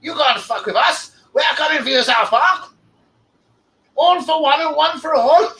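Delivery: high, loud cartoon Canadian accent; South Park Canadian voice. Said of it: "they give them all that voice when they talk like this".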